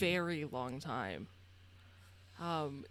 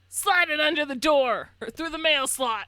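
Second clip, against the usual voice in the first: higher, louder command through the door; gruff order; 8 semitones higher, 8 dB louder than usual. Acted. Old man ordering them to pass money through the slot, gruff. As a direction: bark the instruction.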